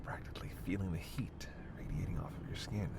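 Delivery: hushed predatory tease, sinking to a whisper; soft vampire temptation; seductive menace. Acted.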